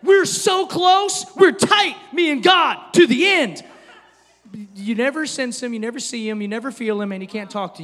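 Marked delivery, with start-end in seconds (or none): 0.0-3.6 loud, high mock boast; over-the-top shout of devotion. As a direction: brag it out in big, punched phrases. Mock boast of closeness to God.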